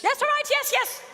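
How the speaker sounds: very high, loud squawked cut-off; flustered interruption. Pretend. Host cuts off talk of how long ago, flustered squawk. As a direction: squawk over him to shut him up. Cuts off maestro counting years.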